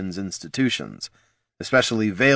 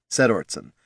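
ordinary delivery, nothing marked

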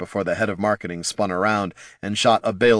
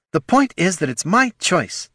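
snapping; 10 semitones higher than usual. Acted. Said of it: "snapped Battelle".

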